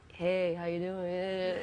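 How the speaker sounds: low, slow American drawl; American accent. Retold or imitated. Daughter answering the phone to her family, low American accent. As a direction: drop deep into an American accent. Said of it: "do the American accent again".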